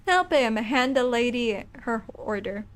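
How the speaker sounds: ordinary talk, nothing marked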